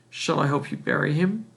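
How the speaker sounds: usual speaking voice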